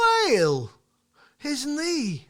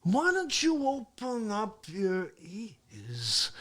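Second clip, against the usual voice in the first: ordinary delivery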